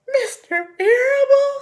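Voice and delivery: very high, loud, wailing question; sobbing disbelief. Acted. Pig sobbing to the sheep about the farmer. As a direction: sob out the name in shock. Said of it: "sobbed Wilbur".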